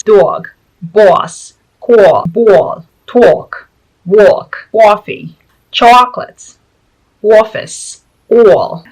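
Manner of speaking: punchy New York vowels, each word loud and separate; drawn-out New York "aw" in each word; New York accent. Shown as a demonstration. Vlogger showing how New Yorkers pronounce these words, New York accent. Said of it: "New Yorkers pronounce very differently".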